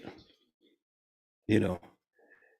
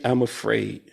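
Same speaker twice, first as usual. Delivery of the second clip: vulnerable admission; 4 semitones lower, 5 dB quieter than usual. Pretend. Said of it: "if we would just simply say".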